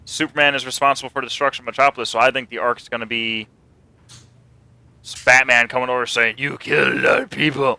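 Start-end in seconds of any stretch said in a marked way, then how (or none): 6.4-7.8 a hint of a grim caped vigilante; lightly voiced stern hero accusing.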